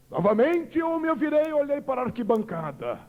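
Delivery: ordinary delivery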